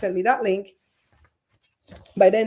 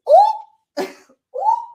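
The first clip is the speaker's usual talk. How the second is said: high yelp of surprise, much higher; surprised oh; 8 dB louder than usual. Real, not acted. Host startled mid gossip, yelping oh. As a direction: jump in pitch at once.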